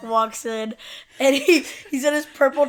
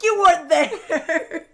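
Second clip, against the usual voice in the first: high, drawn-out mock wail; loud protest; 6 semitones higher, 7 dB louder, slower than usual. Imitating Monk arguing back at the captain, wailed protest. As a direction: stretch it out loud and high, nearly laughing. Acting Monk's pained retort to captain.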